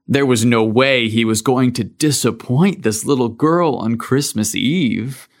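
usual speaking voice

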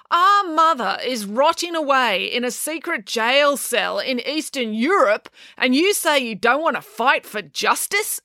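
high, slow, emphatic accusation; accusing. A narrator's reading. April to her reluctant brother about their mother, accusing. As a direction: lay the blame, high and drawn out. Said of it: "accused April".

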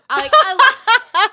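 high, slow words through laughter; real helpless laugh in the voice. Real, not acted. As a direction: crack up while trying to speak. Cracking up at card counting.

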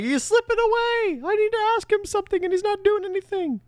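very high mock whine; Fraser. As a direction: complain in a squealing put-on voice. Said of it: "He's like".

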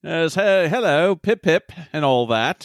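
plummy mock British toff; lightly put-on British accent. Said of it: "some British douchebag".

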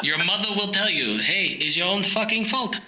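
put-on muddled German-Russian accent, scolding; put-on German accent morphing into Russian; German drifting to Russian. German telling him it's his own fault, wobbly German-Russian accent. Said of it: "starting to sound like a fucking Russian somehow".

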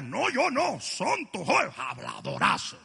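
a hint of a pious hypocrite, pitch swinging; lightly lampoon the self-righteous; mock religious person. Preacher mocking a religious churchgoer, lightly voiced. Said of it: "Si eres religioso dirías".

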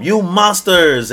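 loud, high, slow dragon cry; mock Momo. Momo posing up as a dragon, loud mock cry. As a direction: stretch it into a big mock roar.

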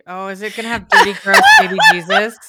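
squealing through laughter; laughing disgust. Real, not acted. Disgusted at the meme.

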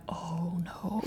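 whispered dread; breathless whisper. Retold or imitated. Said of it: "looked at my notes and went".

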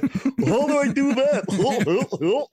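high, very drawn-out goofy voice; hammed-up clueless question, stretched and high; mock dopey voice. Mimicking a dim speaker, jokingly.

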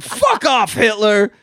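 high, loud, gleeful fuck off; shouted again. Retold or imitated. Relishing the quote for cohosts, high shout. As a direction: belt it with delight. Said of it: "another great line".

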